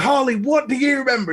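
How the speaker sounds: high, expectant host question; imitated host prompt. Host filling time on the stream, imitated eager prompt. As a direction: pitch it up like an eager presenter.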